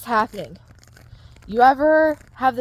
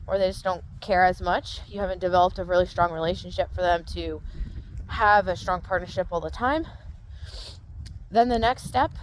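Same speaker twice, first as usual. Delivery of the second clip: usual speaking voice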